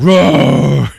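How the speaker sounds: loud drawn-out roar; big mock beast bellow; imitated monster roar. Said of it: "And it's like".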